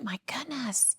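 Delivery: hushed stunned whisper; awed whisper. Retold or imitated. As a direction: breathe it out in disbelief. Reacting to her tragic story.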